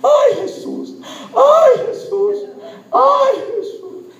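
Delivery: very high wailing outcry to Jesus; re-enacted high, drawn-out scream; imitated cry out. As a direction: act out a sudden piercing cry from the crowd. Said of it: "escucho entre el pueblo un grito".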